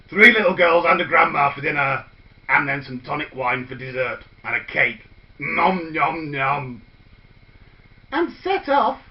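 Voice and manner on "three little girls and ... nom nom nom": loud greedy villain relishing dinner; hungry wolf gloat. Acted. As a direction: savour every bite of the plan. Wolf gloating over planned dinner.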